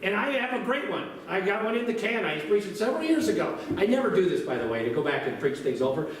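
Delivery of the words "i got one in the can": usual speaking voice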